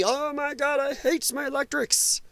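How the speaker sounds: a hint of a shrieking activist; mock lefty. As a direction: parody a sudden outraged convert. Mocking lefties' sudden turn.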